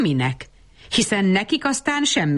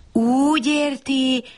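tátott szájjal; 7 semitones higher, slower than usual. A narrator's reading. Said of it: "tátotta el a száját".

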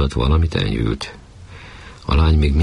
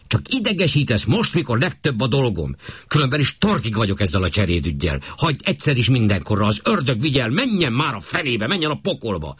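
irritated outburst; 9 semitones higher than usual. Acted. Husband snaps during marital quarrel.

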